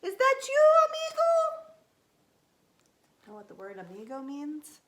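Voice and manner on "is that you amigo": high, loud, hopeful question; excited recognition. A narrator's reading. Space kitten greets his friend, high excited question. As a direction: ask it squealing high with excitement.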